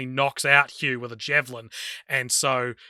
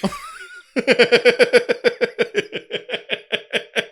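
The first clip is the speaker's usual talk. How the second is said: long laugh; 8 semitones higher, 3 dB louder than usual. Real, not acted. Speaker bursts out laughing.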